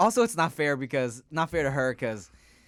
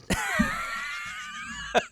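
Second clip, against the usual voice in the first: very high laughing shriek; 5 dB quieter than usual. Real, not acted. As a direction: let out a shrill laugh-scream. Exasperated laugh scream.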